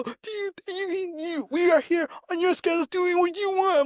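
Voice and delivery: high barking drill sergeant voice, well above his own; mock drill sergeant yell; imitating drill sergeant. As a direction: bark it like a drill instructor mocking a recruit.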